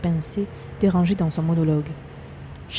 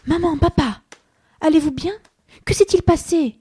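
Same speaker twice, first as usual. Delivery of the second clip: much higher and louder, anxious; worried rush; 10 semitones higher, 6 dB louder than usual. Acted. Son rushes in to his hurt parents, anxious. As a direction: burst in fearing the worst.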